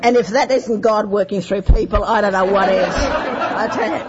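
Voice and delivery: high, loud laughter through the words; laughing as she remembers the joke. Real, not acted. Laughing while telling the group the punchline. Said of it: "and I burst into laughter".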